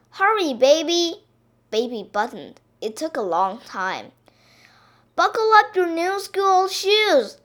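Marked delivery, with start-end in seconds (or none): 0.1-1.2 high, loud hurry-up call. A narrator's reading. Missus Duck hurrying Baby, calling out. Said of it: "called Missus Duck". 5.1-7.4 high, loud fatherly call. A narrator's reading. Mister Duck to Baby about her shoes, calling out. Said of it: "called Mister Duck".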